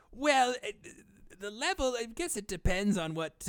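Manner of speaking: high, loud hedging in character; evasive put-on character. Playing evasive villain shopkeeper.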